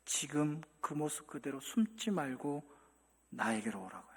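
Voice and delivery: God's quiet, patient invitation; tender invitation. Pretend. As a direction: call them home gently, slowly. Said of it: "하나님 말씀하시죠".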